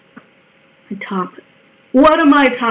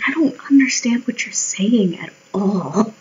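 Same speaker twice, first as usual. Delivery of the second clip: whispered, confused disbelief; baffled whisper; 5 dB quieter than usual. Retold or imitated. To a stranger after a weird remark, a baffled whisper. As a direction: breathe it out like you can't follow them. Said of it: "is just bafflement".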